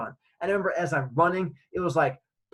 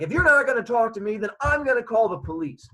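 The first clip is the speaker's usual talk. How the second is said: raised, higher-pitched scolding; stern threat; 6 semitones higher, 4 dB louder than usual. Retold or imitated. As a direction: lay down an angry ultimatum. Angry man threatens the child.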